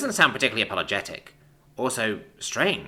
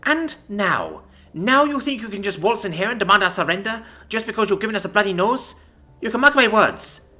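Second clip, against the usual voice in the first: higher, loud defiant roar; defiant shout; 11 semitones higher, 6 dB louder than usual. A narrator's reading. Commander vows to the envoy never to surrender, shouting. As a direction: hurl the vow at him.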